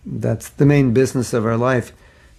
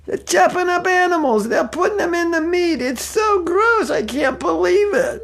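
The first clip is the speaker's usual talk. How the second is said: put-on much higher New York accent, slowing at the end. Devotee in elevator to strangers, high New York accent. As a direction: ham up a disgusted New Yorker. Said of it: "talking real loud in his big New York accent".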